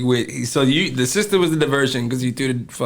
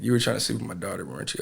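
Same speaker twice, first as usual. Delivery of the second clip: questioning father; 6 semitones lower, 9 dB quieter, faster than usual. Retold or imitated. Father questions him, retold.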